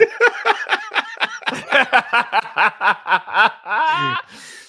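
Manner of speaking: high-pitched burst of real laughter; long loud laugh. Real, not acted. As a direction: crack up loudly and keep going. Joke about delivery order size.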